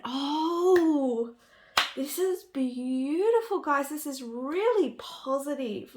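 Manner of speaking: high, bright gasp of real delight, slowed on beautiful; gushing, moved almost to tears by the card; overwhelmed with joy. Real, not acted. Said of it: "I can't even speak".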